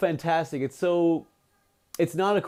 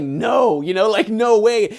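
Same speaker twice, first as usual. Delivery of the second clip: loud, higher acted "no way"; emphatic refusal; 5 semitones higher, 7 dB louder, slower than usual. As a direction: blurt out the disciple's refusal. Disciple refusing again, acted.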